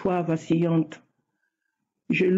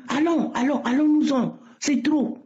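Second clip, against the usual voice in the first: strange voice not hers; 5 semitones higher than usual. Retold or imitated. Retelling the voice that took over at prayer, higher pitched. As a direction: voice raised higher, a stranger speaking through her. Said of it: "Ensuite, elle dit".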